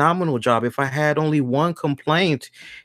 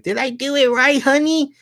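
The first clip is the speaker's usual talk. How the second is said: much higher, sweetly asking approval; mock sweet voice, pitched way up; wife asking her husband; 12 semitones higher than usual. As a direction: coo it up high like an eager wife. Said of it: "Stephanie look at Triple H like".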